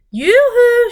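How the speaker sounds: high, loud sing-song call; shouted yoo-hoo. A narrator's reading. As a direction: call out across the meadow. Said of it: "she shouted".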